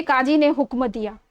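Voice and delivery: usual speaking voice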